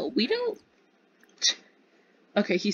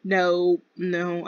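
ordinary talk

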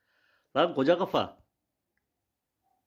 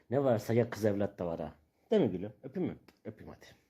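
cooing to pets; 6 semitones lower, 4 dB quieter than usual. Real, not acted. To his pet calves, a soft low croon. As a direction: croon to the animals, much softer.

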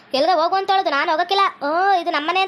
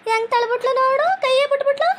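shocked complaint; 6 semitones higher than usual. Acted. Complaining after being shoved away.

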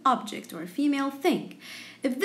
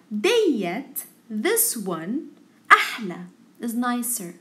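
usual speaking voice

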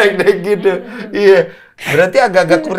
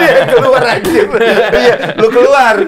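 high, loud words through laughter; laughing disbelief; 6 semitones higher, 5 dB louder than usual. Real, not acted.

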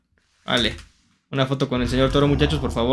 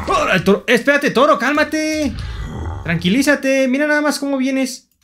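high-pitched yelling at a charging bull; alarmed protest; 8 semitones higher than usual. Pretend. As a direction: fend it off with a high, playful scolding. (protesta aguda y alarmada)

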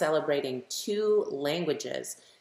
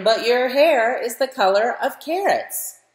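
mildly mocking boy, higher and louder; cousin teasing; 9 semitones higher, 11 dB louder than usual. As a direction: tease her about the carrot hair. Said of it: "and he says".

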